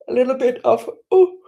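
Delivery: words stretched by a yawn. Real, not acted. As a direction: say it mid-yawn. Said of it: "so I'm sorry if I'm wa-, yawning".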